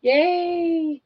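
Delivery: high, sing-song yay from the crowd; mocking a room of cheering dieters. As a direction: chirp it like a fake-happy audience. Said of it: "Everyone go".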